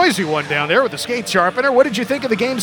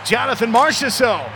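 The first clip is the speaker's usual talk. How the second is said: goal call; 4 semitones higher, 4 dB louder than usual. Real, not acted.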